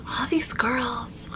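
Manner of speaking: slow, soft whispered Crystal attempt; Crystal impression. Shown as a demonstration. Failing to imitate Crystal, slow whispered try. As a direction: breathe "All these girls" slowly as a whisper. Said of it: "I can't do it".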